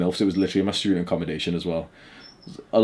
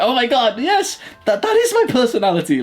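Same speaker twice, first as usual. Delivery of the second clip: high-pitched, hammed-up delight; mock gushing test-taker; 4 dB louder than usual. Mocking someone agreeing with their test result, exaggerated gushing.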